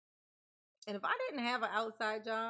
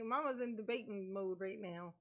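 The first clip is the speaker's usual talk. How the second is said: ordinary delivery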